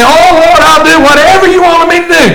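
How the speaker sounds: high, loud begging cry to God; desperate plea. Retold or imitated. Preacher voicing a desperate sinner crying to the Lord. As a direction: plead like someone drowning in trouble.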